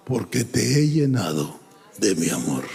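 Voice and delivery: low, solemn divine voice; Espíritu Santo. Retold or imitated. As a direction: drop deep to answer as a voice from heaven. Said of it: "Y la voz del Espíritu Santo". (voz grave y solemne, imitando a Dios)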